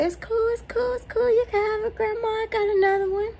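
high, sugary reassurance; sweet high voice. Retold or imitated. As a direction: coax grandma sweetly, high. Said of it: "So I was like".

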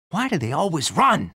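ordinary talk, nothing marked

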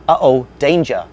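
alarmed cry. Acted. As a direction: cry out a sudden warning. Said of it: "Hubble cried".

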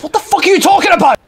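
high, loud, fast indignant shout; outraged yell. Real, not acted. Gamer ranting at the developers' excuse, outraged yell. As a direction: blow up at the absurd excuse.

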